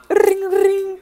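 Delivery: high, loud mock phone ring; hammed-up ringtone imitation. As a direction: chirp out the ring like a toy phone. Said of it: "that little scene".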